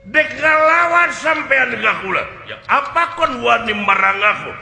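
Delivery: loud, high, defiant fighter's challenge; angry challenge. Acted. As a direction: throw down the gauntlet, shouting. Acted martial arts challenge.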